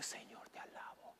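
breathy whisper of praise. Shown as a demonstration. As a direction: whisper it to the Lord. Predicador modela susurro de alabanza. (alabanza susurrada)

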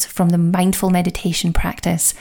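ordinary delivery

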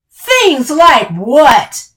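loud, high, slow challenge; demanding. A narrator's reading. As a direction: demand an answer, stretching each word. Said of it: "she demanded".

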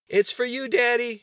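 put-on very high, loud child's yell; shouting. A narrator's reading. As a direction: holler across the house to Daddy. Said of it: "she shouted".

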